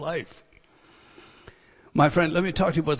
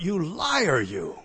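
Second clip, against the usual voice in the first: high-pitched cry; 2 semitones higher, much slower than usual. Retold or imitated. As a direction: imitate an old preacher's high-pitched rebuke. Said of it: "In a high-pitched voice, he cried out".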